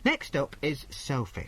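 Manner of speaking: normal talking voice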